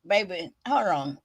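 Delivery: talking around a retainer; words slurred by a retainer; wearing a retainer. Real, not acted. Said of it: "My retainer doing too much".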